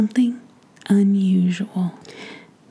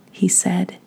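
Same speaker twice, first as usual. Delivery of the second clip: ordinary delivery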